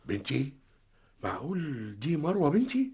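soft, rising disbelief; hardly believe his eyes; father realizing the girl is his daughter, stunned. Acted.

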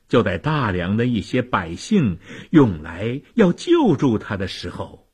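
ordinary delivery

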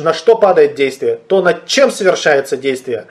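normal talking voice

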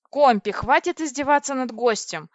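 a touch of loud reproach; reproachful. A narrator's reading. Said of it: "укоризненно сказала я".

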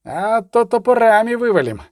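impatient urging, rising into a threat; moderately forceful command; barked threat. A narrator's reading. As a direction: bark a quick threat at the gate. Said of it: "гаркнув Федор".

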